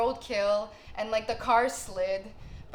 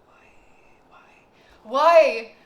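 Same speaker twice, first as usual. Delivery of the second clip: starts in a whisper, bursts into a loud, higher cry; whisper to shout; 5 semitones higher, 15 dB louder, much slower than usual. Real, not acted. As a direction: build from a whisper to a despairing yell. Crying out to God over flood.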